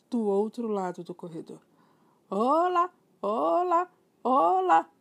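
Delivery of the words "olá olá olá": high, loud parrot squawk from the chimney; parrot calling. Acted. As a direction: call out like a parrot from inside. Parrot heard from next room.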